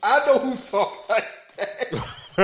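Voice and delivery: slow, high, laughing through the words. Real, not acted. A host laughing while recalling a wrestler's promo, slow. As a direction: laugh as you say it. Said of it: "I started laughing my ass off".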